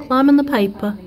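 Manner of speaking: high, gentle coaxing to a bee. Real, not acted. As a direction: coax it like a small creature. Coaxing a bee onto paper.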